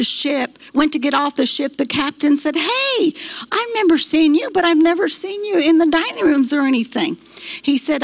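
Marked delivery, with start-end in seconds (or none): 2.6-7.2 higher-pitched retold captain's hail; calling out. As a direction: hail a passenger you recognise, puzzled. Acts ship captain hailing a passenger.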